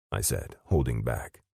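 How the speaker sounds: ordinary delivery, nothing marked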